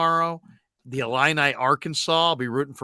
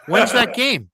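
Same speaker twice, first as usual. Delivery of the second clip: incredulous; 8 semitones higher, 5 dB louder than usual. Retold or imitated. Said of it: "I'm like".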